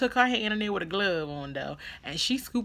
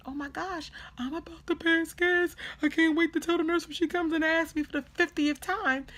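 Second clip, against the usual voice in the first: high-pitched, giddy inner voice; eager excitement; 12 semitones higher than usual. Retold or imitated. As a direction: act out eager anticipation in a high voice. Eager thought about finally passing gas.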